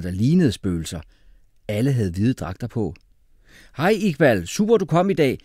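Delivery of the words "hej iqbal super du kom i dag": high, loud, eager hello; shouted greeting. A narrator's reading. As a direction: call out a welcome. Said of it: "råbte Jeppe".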